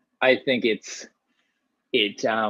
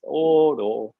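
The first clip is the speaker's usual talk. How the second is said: put-on Canadian accent; 4 semitones higher than usual. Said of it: "My dad, anyways".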